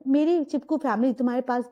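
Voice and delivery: usual speaking voice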